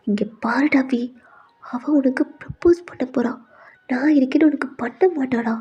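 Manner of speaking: soft, hushed aside to a friend; confiding half-whisper. Acted. Friend telling V about Alia's proposal, hushed. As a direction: let it out quietly, partly whispered.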